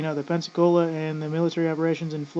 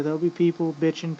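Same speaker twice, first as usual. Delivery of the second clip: ordinary talk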